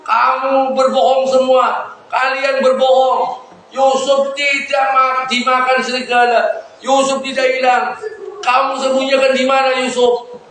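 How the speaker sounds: acted accusation. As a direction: accuse the sons, stretching out the words. Father accuses sons of lying.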